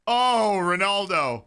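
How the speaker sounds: high, loud anguished exclamation; loud pained outcry; dismayed cry. Real, not acted. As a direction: groan the name in loud dismay. Reacting to miss live.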